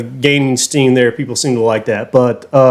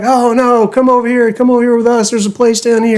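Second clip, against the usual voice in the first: put-on pleading young guys; 9 semitones higher than usual. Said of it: "They're like".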